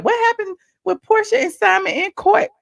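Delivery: high-pitched eager questioning; imitated nosy viewer. Somebody in chat asking about court, imitated curiosity.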